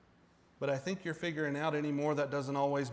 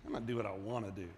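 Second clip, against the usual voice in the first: put-on dismissive child; 6 semitones lower than usual. Acting out a defiant child.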